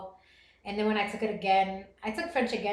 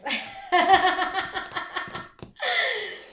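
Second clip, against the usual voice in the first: long, high cackling laugh; real belly laugh; 9 semitones higher, 3 dB louder than usual. Real, not acted. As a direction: break the silence with helpless high laughter. Laughs after moment of silence.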